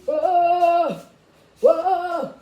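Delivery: loud, high startled whoa; big surprised exclamation. Real, not acted. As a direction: burst out in shock.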